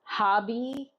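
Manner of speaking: hobby pronounced HAH-bee; their supposed pronunciation. Shown as a demonstration. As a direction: open "ah" in the first syllable of hobby. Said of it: "is how they pronounce".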